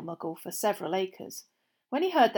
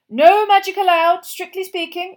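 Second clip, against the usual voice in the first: eager; 9 semitones higher, 13 dB louder than usual. A narrator's reading. Mr Weasley, eager camper.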